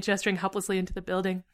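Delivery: plain reading voice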